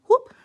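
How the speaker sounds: short bright whoop; whoop sound. Shown as a demonstration. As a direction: call out a quick whoop. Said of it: "what was the sound I make".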